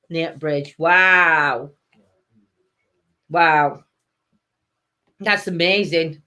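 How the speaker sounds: ordinary talk, nothing marked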